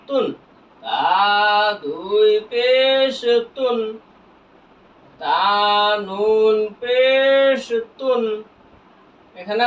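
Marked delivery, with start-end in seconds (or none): 0.8-3.8 very slow, vowels held long; measured recitation drill with long-held syllables; drawn-out spelling. Shown as a demonstration. Same spelling repeated drawn out. 5.2-8.2 very slow recitation drill; each letter name held out slowly; drawn-out spelling. Shown as a demonstration.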